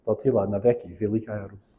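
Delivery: soft, low-key Russian recitation in a bad accent; Russian anthem murmured with a poor accent; terrible Russian accent. Real, not acted. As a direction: quote it quietly in badly accented Russian. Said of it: "badly, 'cause my accent is terrible".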